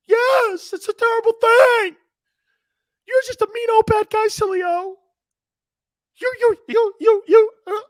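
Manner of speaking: loud, very high falsetto scolding; hammed-up squeaky indignation, stammering at the end; mock indignant snowflake. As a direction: squeal accusations like an offended caricature. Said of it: "I've been talking to snowflakes on the West Coast".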